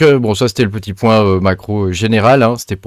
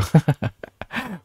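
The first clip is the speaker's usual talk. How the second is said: short breathy chuckle; amused chuckle; 7 semitones higher, 5 dB quieter than usual. Real, not acted. Vlogger amused by two clashing news items, chuckling. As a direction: chuckle at your own remark. Laughs at his own joke.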